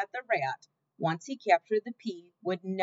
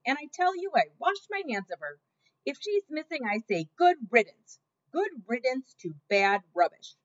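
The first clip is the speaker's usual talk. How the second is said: louder and louder, hands washed of her; shouting; 5 semitones higher, 5 dB louder than usual. A narrator's reading. Louise shouting good riddance at Cook, loudly. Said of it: "Louise was shouting".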